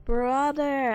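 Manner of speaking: usual speaking voice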